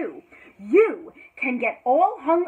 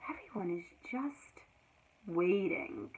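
hushed, breathy whisper; whispered aside to the listener; 7 semitones lower, 11 dB quieter than usual. Acted. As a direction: let it drop to a secret whisper. Whispered refrain in reading.

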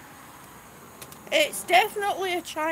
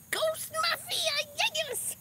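high, drawn-out delighted call; excited greeting; 12 semitones higher, slower than usual. Real, not acted. Streamer spotting a viewer in the chat, excited greeting. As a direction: sing out the name, pitched way up.

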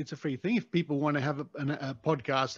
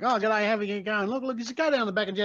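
fast, higher-pitched Australian slang; heavy Australian accent; 6 semitones higher, faster than usual. Shown as a demonstration. Host shows what backpackers can't follow, heavy fast Australian. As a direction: rattle it off in thick Australian. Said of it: "a heavy Australian accent and you use slang".

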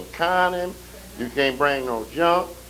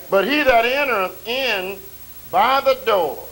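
higher and louder, slow and rising; preaching swell; 5 semitones higher, 6 dB louder, slower than usual. Acted. Preacher raising the scripture to the church, louder and higher. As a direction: lift the line up to the congregation.

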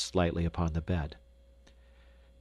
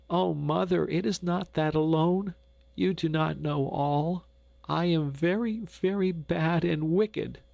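higher, pleading through tears, slowing on "very bad and wicked"; a sobbing girl confessing her guilt; tearful confession; 9 semitones higher than usual. Gretel confessing to her mother, tearful and pleading. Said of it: "Gretel sobbed now".

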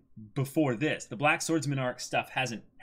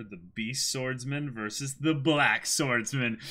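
ordinary talk

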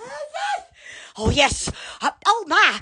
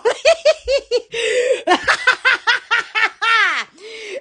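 real, higher cackling fit; long cackle; 4 semitones higher than usual. Real, not acted. Host overcome while presenting a bottle, long cackling fit. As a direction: laugh on and on helplessly.

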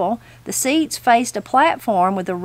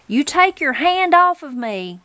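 higher-pitched, mildly indignant granny; 6 semitones higher than usual. Acted. Granny to the man grabbing her elbow, indignant. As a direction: object to being handled, pitched up.

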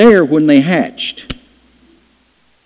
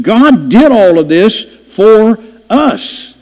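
higher, louder preaching, slowing on key lines; fervent preaching; 5 semitones higher, 3 dB louder than usual. Acted. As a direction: build the congregation up with praise. Preaching at full force.

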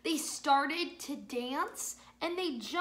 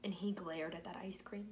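whisper; 5 semitones lower, 8 dB quieter than usual. Acted. Suspense in children's story.

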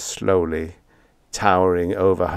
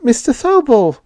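much higher, louder hurried address; much higher hurried landlady; quick nervous call; 7 dB louder than usual. A narrator's reading. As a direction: blurt his name to stop him. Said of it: "she said quickly".

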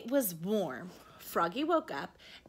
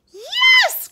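very high, loud yes; excited cry; 18 dB louder than usual. A narrator's reading. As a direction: shout it with a burst of eagerness. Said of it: "cried Froggy".

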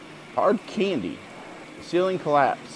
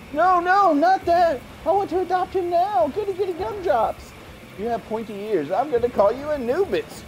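put-on much higher, eager kid voice; high excited character; 12 semitones higher than usual. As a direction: beg to adopt him now. Voicing character adopting dog.

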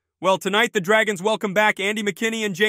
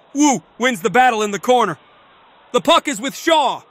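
ordinary talk, nothing marked

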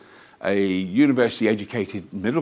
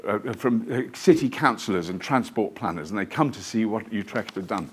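usual speaking voice

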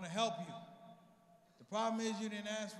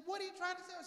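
mom's voice pitched way up, puzzled; high mom voice; 10 semitones higher, faster than usual. Retold or imitated. Mother pushing her son, high and puzzled. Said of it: "She said".

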